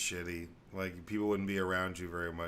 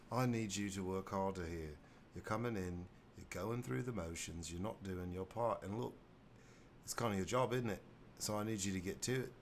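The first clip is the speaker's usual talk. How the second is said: lightly British boss telling off a worker; a hint of British manager scolding; British accent. Boss telling off a lazy worker, in a light British accent. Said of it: "what would it sound like?".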